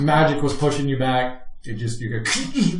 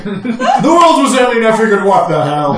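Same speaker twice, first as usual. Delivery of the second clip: loud protesting excuse; 8 semitones higher, 7 dB louder than usual. Real, not acted. Defending the joyride to laughing players, loud protest. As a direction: belt out the excuse over the laughter.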